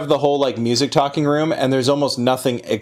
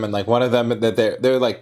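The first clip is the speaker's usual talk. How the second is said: ordinary talk, nothing marked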